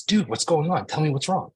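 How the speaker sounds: high, loud, pressing questions; urgent fix-it energy. Acted. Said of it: "my first instinct is doing energy".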